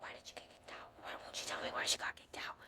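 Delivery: whispered inner thoughts; hushed, intrigued whisper. Shown as a demonstration. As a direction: whisper his nagging questions. Imagined guy's curious thoughts.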